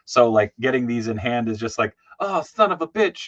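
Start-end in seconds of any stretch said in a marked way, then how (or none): 2.2-3.3 excited exclamation. Retold or imitated. Excited reaction to new toys.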